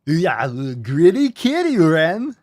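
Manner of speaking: loud, slow cartoon character voice. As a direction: do a Ren and Stimpy cartoon character. Ren and Stimpy impression.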